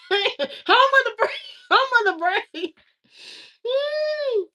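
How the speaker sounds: high, laughing mock anthem line. Real, not acted. Vlogger laughing as she mocks America. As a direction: laugh through it with mock pride.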